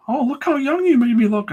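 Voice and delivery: high, delighted middle-aged lady. Retold or imitated. Middle-aged lady thrilled by her caricature, high and gushing. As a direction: gush like a flattered client. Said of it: "middle-aged ladies love my caricatures".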